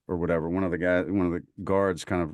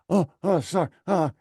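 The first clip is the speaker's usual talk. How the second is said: high flustered sorry; imitating a mortified kid, pitch jumping up; mortified apology; 9 semitones higher than usual. Private apologising to the sergeant, high and flustered. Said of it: "He was like".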